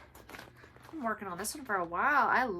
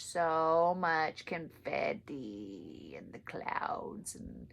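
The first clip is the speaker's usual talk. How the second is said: slow whisper; 2 semitones lower, much slower than usual. Real, not acted. Groaning about hard stitching.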